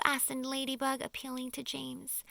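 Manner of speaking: usual speaking voice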